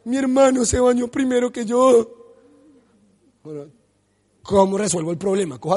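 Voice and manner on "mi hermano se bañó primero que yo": complaining daughter. Retold or imitated. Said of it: "Cuando logra decirme el problema es".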